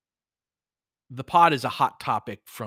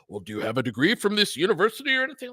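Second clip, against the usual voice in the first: fast, high prim interviewer; mock employer voice; 7 semitones higher, faster than usual. Coach imitates a gatekeeper questioning his credentials, fast and high. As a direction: ape a snooty hiring manager quickly. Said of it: "be like".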